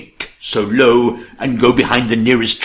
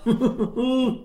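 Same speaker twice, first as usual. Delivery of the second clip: cackling laugh; 12 semitones higher, 9 dB quieter than usual. Acted. King laughs after menu.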